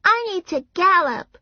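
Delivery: put-on very high child voice; high-pitched little-kid complaint; complaining little girl. A narrator's reading. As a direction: whine like a small girl.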